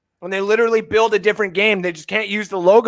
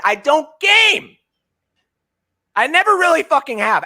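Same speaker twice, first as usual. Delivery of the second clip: emphatic; 7 semitones higher than usual. Real, not acted. Ranting about Madden.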